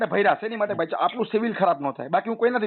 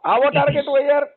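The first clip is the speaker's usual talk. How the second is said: exclaiming; 5 semitones higher, 4 dB louder than usual. Real, not acted. Laughing exclaim at bank pressure.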